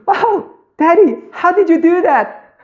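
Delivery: put-on child marvelling at daddy's trick; little girl amazed. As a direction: play a wide-eyed little daughter. Daughter amazed by dad.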